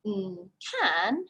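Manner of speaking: ordinary talk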